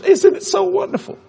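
high, gushing delight; mock-thrilled, high-pitched gush; gushing wife. Wife cheering her husband's move into ministry, gushing. Wife's delighted reply to unemployment.

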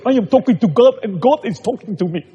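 imitating the convert. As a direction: show how the man now proudly says it. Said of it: "and talk like this".

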